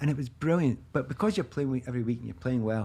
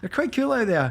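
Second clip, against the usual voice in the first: casual bravado; 9 semitones higher, 4 dB louder than usual. Retold or imitated. Acts casual bravado to teammates.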